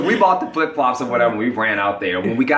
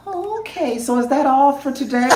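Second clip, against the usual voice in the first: cashier voice; 12 semitones higher than usual. Retold or imitated. Disney lady voiced.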